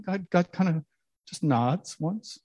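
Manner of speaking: usual speaking voice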